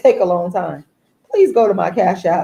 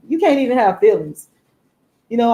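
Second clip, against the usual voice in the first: ordinary delivery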